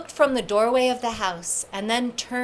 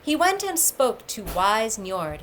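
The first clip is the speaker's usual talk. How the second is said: ordinary delivery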